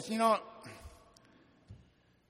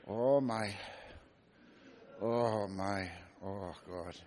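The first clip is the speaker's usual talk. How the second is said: Irish brogue; 6 semitones lower, 4 dB quieter, much slower than usual. Retold or imitated. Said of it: "He had this deep Irish brogue".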